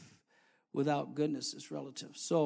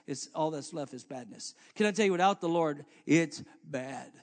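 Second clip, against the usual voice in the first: usual speaking voice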